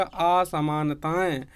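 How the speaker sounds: असमानताएं slowly broken as आ, समान, ताएं; pauses inside असमानताएं; split into syllables. Shown as a demonstration. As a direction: sound out असमानताएं piece by piece. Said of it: "आ अलग बोलेगा".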